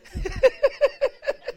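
high burst of laughter. Real, not acted. Preacher laughing at the roundabout story, high. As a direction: laugh out at the joke.

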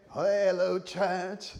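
high, hammed-up call to a crowd; excited greeting. Abraham handed the mic at church, excited greeting. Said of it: "Abraham will be here saying".

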